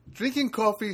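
hint of a thick Spanish accent impression; imitated Spanish accent. Building manager on coffee without sugar, imitated Spanish accent. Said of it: "in a very thick Spanish accent".

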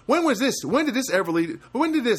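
mock-outraged questioning; indignant protest. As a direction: challenge him with high, emphatic disbelief. Acted indignant argument with neighbour.

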